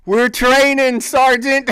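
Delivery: high, loud gung-ho reply; retold eager shout. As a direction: bellow it with mock eagerness. Soldiers shouting back.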